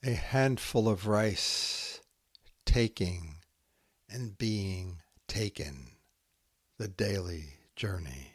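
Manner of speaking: slow, hushed haiku recital with long pauses; poem reading. Acted. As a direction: let each haiku settle into silence. Reads Santoka haiku aloud.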